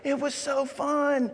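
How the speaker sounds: put-on high, slow, dreamy little girl voice; sweet little girl. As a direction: gush it like a sweet young student. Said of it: "this sweet little girl".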